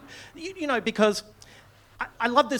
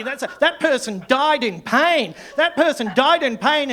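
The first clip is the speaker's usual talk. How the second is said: loud, raised, indignant scolding; imitating angry complainant; 5 semitones higher, 5 dB louder than usual. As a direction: hammer out the accusation in mock outrage. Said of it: "this person was angry at me".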